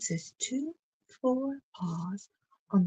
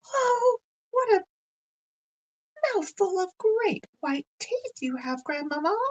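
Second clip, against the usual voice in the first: put-on much higher and louder astonished child; amazed; 12 semitones higher, 5 dB louder than usual. Blanche amazed at the wolf's big tongue, squealing high. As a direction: squeal it out in wide-eyed wonder.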